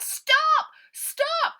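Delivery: very high, loud desperate yell; cried out. Acted. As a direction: scream after the departing ship. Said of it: "From way down in her throat, she cried".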